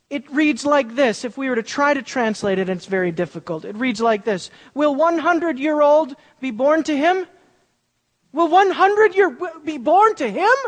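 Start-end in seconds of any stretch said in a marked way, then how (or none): 4.7-10.6 higher and louder, halting and muddled; confused Abraham. Retold or imitated. Preacher voicing a confused Abraham, higher and louder. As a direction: stumble through it like a flustered old man. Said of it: "Abraham was confused".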